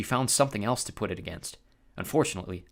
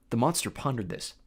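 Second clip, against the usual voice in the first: ordinary delivery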